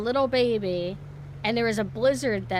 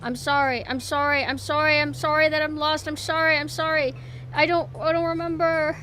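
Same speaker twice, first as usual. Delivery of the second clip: mock apology; 5 semitones higher, 3 dB louder than usual. Apologizing to game character.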